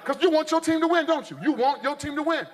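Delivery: ordinary talk, nothing marked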